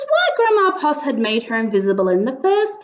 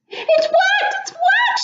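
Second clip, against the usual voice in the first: much higher, rising delighted cry; squealing happy grandma; joyful cry. Acted. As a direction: cry out in triumph. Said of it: "she cried".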